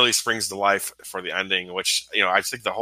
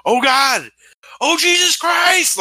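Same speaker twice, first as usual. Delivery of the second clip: acting out a horrified scream, much higher and louder; imitated scream; 7 dB louder, slower than usual. Imitating the movie's hero seeing the Wicker Man, screamed. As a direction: cry out loud and high, imitating a doomed man. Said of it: "the way he screams".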